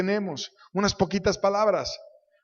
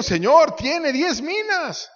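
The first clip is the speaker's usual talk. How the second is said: astonished protest; 11 semitones higher, 5 dB louder, slower than usual. A narrator's reading. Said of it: "Y ellos le dijeron".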